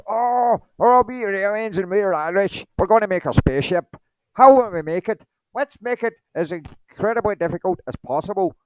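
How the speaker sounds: loud, high, hammed-up Irish voice; terrible Irish accent. Irish aliens planning their spaceship, loud terrible Irish accent. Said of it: "that was terrible Irish accent there".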